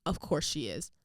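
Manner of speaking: imitating coach, low and unimpressed; dismissive coach. Coach hearing player is throwing up, dismissive. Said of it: "you know what he said".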